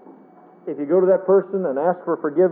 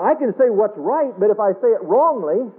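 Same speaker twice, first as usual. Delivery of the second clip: usual speaking voice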